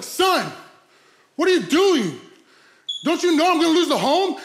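loud, high imitation of a scolding father; stressed dad. Dad coming out to confront his son, stressed scolding. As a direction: lay into your son in mock alarm. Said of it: "stressing me out hardcore".

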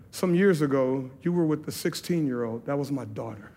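soft, low, grave retelling; retold sobbing woman. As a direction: quote her painful confession quietly, without sobbing. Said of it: "She's just sobbing".

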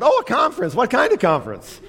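high, eager chatty lady; nosy chatterbox. Retold or imitated. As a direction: jump in high and eager like a talkative stranger. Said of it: "She said".

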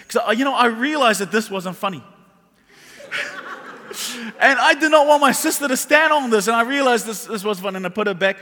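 ordinary delivery

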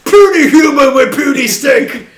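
loud, monster-like brute voice; dragon voice. Retold or imitated. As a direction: boom it like a dumb beast. Voicing Braun as a monster.

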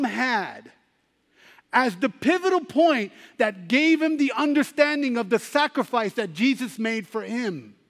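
full-force sermon climax over music; preaching at full force. Acted. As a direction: build the altar call with fervour.

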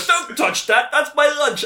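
high, loud indignant yelp, slowing; guard the lunch, outraged; mock Q shout. Q line again.

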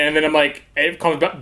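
ordinary delivery

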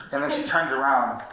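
normal talking voice

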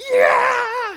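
loud high-pitched battle cry; game fighter yell. Retold or imitated. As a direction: yell like a brawler punching. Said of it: "my guy would be like".

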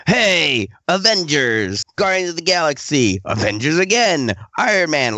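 high, singsong parade of titles; mock-excited Marvel roll call; showy announcing. Marvel announcing hit after hit.

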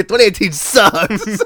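high laughing complaint. Real, not acted. Laughing at being sick.

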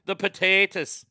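"potatoes" said long in mock Irish; drawn-out potatoes. Shown as a demonstration. Host repeats it for viewers, "potatoes" drawn out long. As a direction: stretch the middle vowel of "potatoes". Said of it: "you have to say it long like that today".

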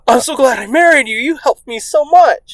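high-pitched gushing gratitude; mock gushing. Grateful husband to wife, mock gushing. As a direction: lay on the adoring thanks thick.

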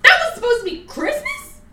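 high, loud disbelieving question; incredulous. Retold or imitated. Mocking the sequel to her cohost, high loud disbelief. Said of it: "I'm like".

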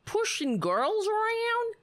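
mocking high echo. Echoing Palin from the clip.

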